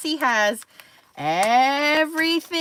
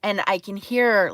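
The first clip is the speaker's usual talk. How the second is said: ordinary delivery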